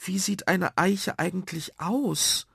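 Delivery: high, slow wondering question; child asking. Acted. Ada asks about oak trees, wondering. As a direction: ask slowly in a child's high voice. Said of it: "und fragte".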